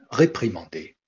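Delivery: ordinary talk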